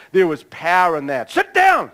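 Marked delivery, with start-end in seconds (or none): 1.3-1.9 sudden very high, loud shout; explosive re-enacted order; shouted command. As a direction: bellow it with full authority. Replays his command to show power.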